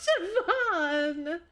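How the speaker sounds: high, drawn-out shocked exclamation; scandalized cry. Acted. Reacting to Siobhan's crude joke, scandalized high cry. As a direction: cry the name out high, stretching it.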